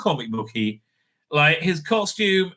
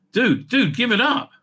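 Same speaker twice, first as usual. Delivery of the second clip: higher, exasperated appeal; exasperated plea; 6 semitones higher, 3 dB louder than usual. Real, not acted. Vlogger to a persistent caller, exasperated. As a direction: plead with the caller to stop. Phone keeps ringing during recording.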